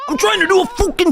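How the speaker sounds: very high mock wail; mocking imitation. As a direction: parody his outburst in a squeaky high pitch. Mimics the clip's shouted line.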